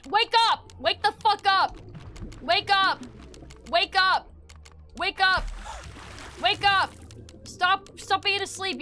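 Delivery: high, loud, repeated shouts; yelling to wake someone. Pretend. Player shouting at sleeping character, urgent yell. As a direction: bark it sharply to rouse a sleeper.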